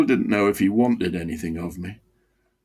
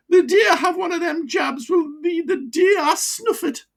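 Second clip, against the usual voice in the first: put-on old Wearside miner, bitterly defiant. Old miner refusing his doctor's jab, defiant.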